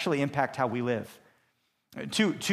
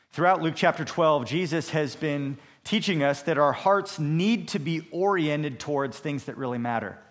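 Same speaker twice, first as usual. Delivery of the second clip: ordinary talk, nothing marked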